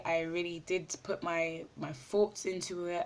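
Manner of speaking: ordinary talk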